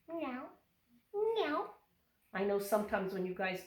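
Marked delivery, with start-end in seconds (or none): 0.1-0.4 put-on a quiet kitten meow, pitched up for the kids; soft playful meow. Yoga teacher playing the cat for children, soft high meow. 1.3-1.6 a bright, much higher cat meow; high playful meow. Pretend. Teacher voicing the cat in cat pose, high meow.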